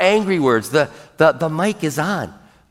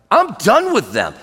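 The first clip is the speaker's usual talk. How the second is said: higher-pitched, emphatic exasperation; God; 4 semitones higher than usual. Acted. Deity fed up with the murmuring people, exasperated. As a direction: burst out as if finished with them. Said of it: "God's like".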